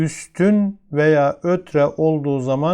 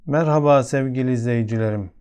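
usual speaking voice

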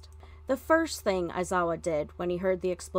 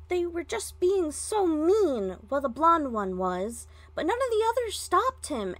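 put-on much higher, childlike pouting creature voice; sulky shadow creature; 8 semitones higher than usual. A narrator's reading. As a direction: whine out the excuse like a caught kid. Sentient quirk confesses to teacher.

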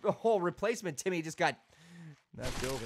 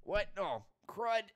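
usual speaking voice

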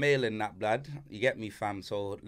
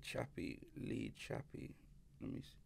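whisper; 6 semitones lower, 22 dB quieter than usual. Real, not acted. Searching for his voice notes.

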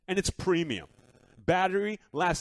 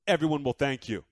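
ordinary talk, nothing marked

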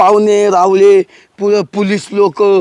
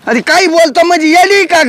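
shouting at her; 8 semitones higher than usual. Acted. Lover raises his voice at his runaway bride, shouting. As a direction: snap back at her, voice raised high. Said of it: "ओरडू नको माझ्यावर".

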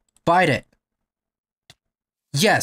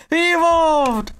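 excited shout; 11 semitones higher, 4 dB louder, slower than usual. Real, not acted. Repeated evolve cry.

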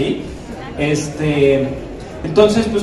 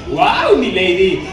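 superhero flirt; 4 dB louder, much slower than usual. Shown as a demonstration. Cat Noir greeting his lady, playful and showy. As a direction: charm her like a cocky hero. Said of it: "empieza a hablar como así".

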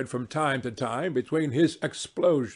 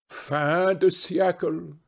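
plain reading voice

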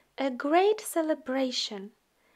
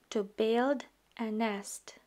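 ordinary delivery

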